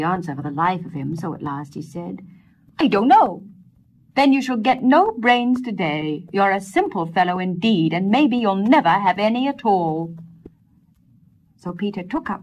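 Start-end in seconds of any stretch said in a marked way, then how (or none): 2.8-3.4 loud blurted admission. Acted. Peter to the wise woman, blurting in defeat. Gives up. 4.2-10.1 louder, scornful dismissal; scornful wise woman. Acted. Wise woman dismissing simple Peter, louder and scornful.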